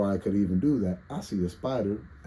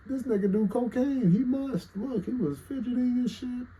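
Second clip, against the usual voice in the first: much higher mocking voice of gossiping viewers. Streamer mocking viewers who assume he uses drugs, high voice. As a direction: sneer it like a nosy viewer. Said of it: "I don't want motherfuckers thinking".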